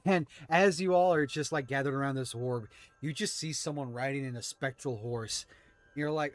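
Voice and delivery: usual speaking voice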